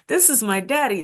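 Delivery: ordinary delivery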